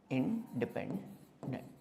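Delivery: "Independent" split slowly into in, depend, dent; low, quiet, drawn-out "independent"; slow dictation. Shown as a demonstration. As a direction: say "independent" softly, piece by piece with pauses. Repeats term slowly while teaching.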